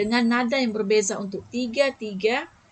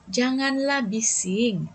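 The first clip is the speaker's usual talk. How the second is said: slow, pleading janganlah bising; soft pleading; 3 semitones higher, slower than usual. Shown as a demonstration. Teacher showing pupils a soft, pleading request. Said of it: "lebih lembut dan seolah merayu".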